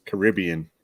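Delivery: Caribbean as kuh-RIB-ee-un, second syllable stressed. Shown as a demonstration. Host demonstrates the tricky drink name, Caribbean stressed on RIB. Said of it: "complicated part of the name is the".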